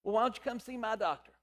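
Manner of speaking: ordinary talk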